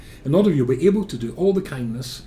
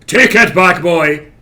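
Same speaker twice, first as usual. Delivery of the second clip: very loud, raised-pitch order; stern shout; 7 semitones higher, 16 dB louder, slower than usual. Retold or imitated. Strict mother overruling her son, in a stern shout. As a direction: bellow over the child's protest.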